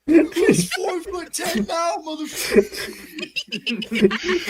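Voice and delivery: laughing shout. Pretend. Mocking a short friend, laughing.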